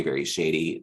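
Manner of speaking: ordinary delivery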